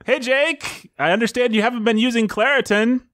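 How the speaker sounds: high-pitched pretend greeting; imagined scene. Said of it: "Let's imagine it".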